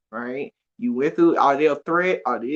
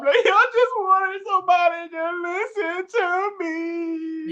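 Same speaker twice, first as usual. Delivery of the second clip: high, slow, tearful plea; a touch of crying; 13 semitones higher, slower than usual. Retold or imitated. Confessing to her therapist, slow and teary. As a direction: let it break like a hurt kid. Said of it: "I was like".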